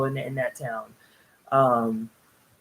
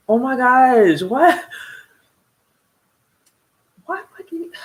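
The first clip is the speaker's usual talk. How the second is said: getting teary; 9 semitones higher, 7 dB louder than usual. Real, not acted. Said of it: "I got emotional thinking about my mother".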